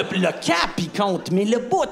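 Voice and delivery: normal talking voice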